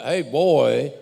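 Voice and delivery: put-on loud, sharp call of "Hey, boy.". Shown as a demonstration. To Vince, the heated "Hey, boy." he didn't say, called out loud. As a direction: bark "Hey, boy." like hailing someone across the room.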